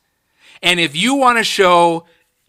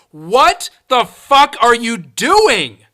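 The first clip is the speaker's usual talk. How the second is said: slow, high-pitched exasperated yell; 4 semitones higher, slower than usual. Retold or imitated. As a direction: re-enact yelling at the screen, stretching each word. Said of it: "so many times yelling".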